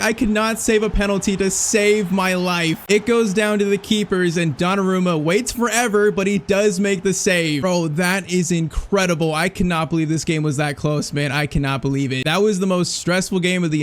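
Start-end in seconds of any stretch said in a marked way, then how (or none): none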